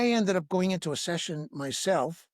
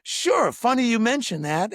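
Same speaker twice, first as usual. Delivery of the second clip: amused reply; 5 semitones higher, 5 dB louder than usual. Retold or imitated.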